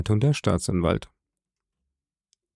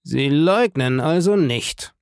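menacing smile; 4 semitones higher, slower than usual. A narrator's reading. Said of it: "mit einem Lächeln".

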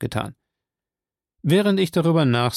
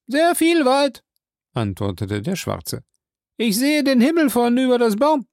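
Jupiter's voice; 11 semitones higher than usual. Acted. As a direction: pitch him well up and call out. Jupiter answers from the tree.

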